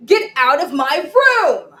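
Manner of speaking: loud angry yell; shouted. Acted. Child yelling at her baby brother, loud and cross. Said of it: "So I shouted".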